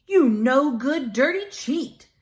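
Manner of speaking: loud, slow, furious shout. A narrator's reading. Passerby yelling at the cheat, loud angry shout. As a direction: yell it at the cheating hustler. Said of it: "he shouted".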